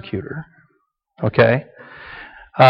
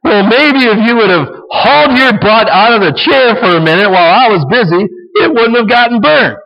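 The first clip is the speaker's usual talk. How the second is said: mock angry wife, much higher and louder; fed-up wife; 8 semitones higher, 4 dB louder than usual. Wife turning on lazy husband, mock angry. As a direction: snap back at him in a hammed-up nagging voice. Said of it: "She's gonna be the persecutor".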